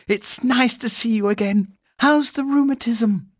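put-on high-pitched girlish chat; friendly girl. A narrator's reading. Girl asking after the housekeeper's rheumatism, friendly. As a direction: greet her in a high, loud child's voice.

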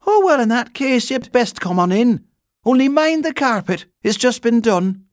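loud, high, fussy instructions; bustling housekeeper. A narrator's reading. Housekeeper letting the bear in, bustling. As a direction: usher him in with a high, strident voice.

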